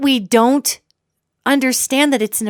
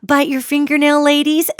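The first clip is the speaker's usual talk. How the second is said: higher, sugary mocking voice; mock sweet preacher; 6 semitones higher than usual. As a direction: parody a syrupy church lady telling girls to smile. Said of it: "especially this".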